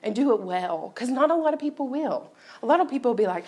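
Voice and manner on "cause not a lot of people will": ordinary talk, nothing marked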